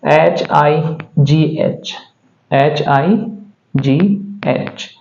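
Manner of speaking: slow letter-by-letter spelling; एच आई जी एच said one letter at a time, slowly; spelled out slowly. Shown as a demonstration. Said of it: "पहला है".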